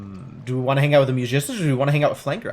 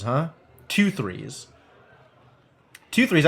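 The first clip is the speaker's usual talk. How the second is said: usual speaking voice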